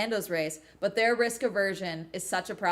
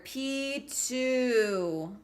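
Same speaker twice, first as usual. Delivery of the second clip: usual speaking voice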